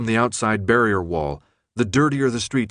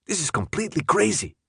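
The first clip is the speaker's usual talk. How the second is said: higher, louder whisper; 8 semitones higher than usual. A narrator's reading. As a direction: whisper rising high in alarm. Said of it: "Jorge whispered".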